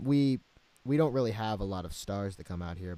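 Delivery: usual speaking voice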